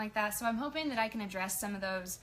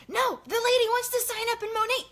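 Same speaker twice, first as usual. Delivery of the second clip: high, loud excited quote; high amused voice of the sister-in-law; 12 semitones higher, 6 dB louder than usual. Retold or imitated. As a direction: squeal her news. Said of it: "She goes".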